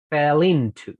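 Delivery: "fell into" joined as "fe-linto"; linked. Shown as a demonstration. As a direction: move the l onto "into". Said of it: "Es como que".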